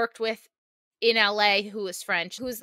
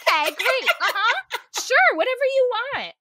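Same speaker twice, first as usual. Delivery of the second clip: much higher, louder, giggly yes-yes; starstruck eager agreement; 12 semitones higher, 4 dB louder than usual. Pretend. Giving in to a charming French woman, giggly gushing. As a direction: gush along like a smitten pushover.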